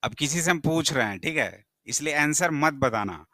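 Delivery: normal talking voice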